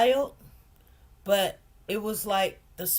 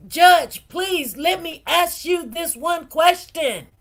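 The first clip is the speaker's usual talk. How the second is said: loud, higher, slow pleading with the judge; tearful begging; 6 semitones higher, 7 dB louder, slower than usual. Retold or imitated. Mother begging the judge for one question, loud tearful plea. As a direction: beg him through tears, slowly. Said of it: "tears in my eyes".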